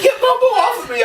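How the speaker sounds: high, laughing mock squeal; panicked plea. Deputy begging to get the dog off, high mock panic. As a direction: beg for help in a squeaky panic. Deputy pleading while bitten.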